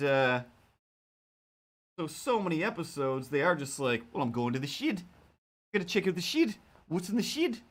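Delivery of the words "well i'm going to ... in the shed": put-on New Zealand accent rising much higher on "shed"; imitating Kiwi contestants, "shed" like "shid". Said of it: "that's just music to my ears".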